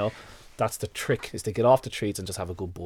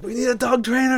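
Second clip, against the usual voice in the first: much higher mock wife voice; imitating the wife; 13 semitones higher, 4 dB louder than usual. Wife insisting they need a trainer, high mock voice. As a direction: parrot the wife's demand. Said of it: "My wife's saying".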